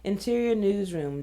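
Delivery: ordinary talk, nothing marked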